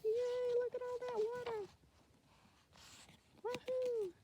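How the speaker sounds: very high, soft squeaky fish voice; happy fish voice. Pretend. The fish cheers at all the water, delighted. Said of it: "He's like".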